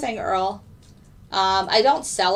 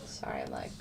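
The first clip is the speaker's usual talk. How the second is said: whispered through a burp; breathy burp-affected voice; burping after fizzy drink; 15 dB quieter than usual. Real, not acted. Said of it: "burping again".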